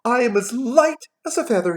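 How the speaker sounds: high, giddy exclamation; excited. A narrator's reading. Scrooge newly reborn, exclaiming giddily. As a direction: proclaim it high, with glee. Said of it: "He's getting a little excited".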